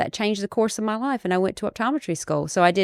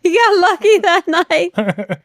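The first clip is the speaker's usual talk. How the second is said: real laugh through the words, higher and louder, slow; giggling tease, much higher; 12 semitones higher, 7 dB louder, slower than usual. Real, not acted. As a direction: laugh it out teasing her husband. Teasing laugh about husband.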